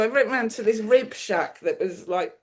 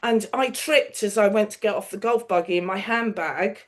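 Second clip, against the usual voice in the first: ordinary delivery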